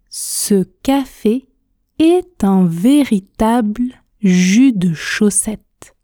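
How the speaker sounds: very slow, pausing between word groups; tortoise speed. Shown as a demonstration. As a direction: lead a slow, articulated repeat-along. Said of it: "Répétez avec moi à la vitesse tortue". (très lentement, vitesse tortue)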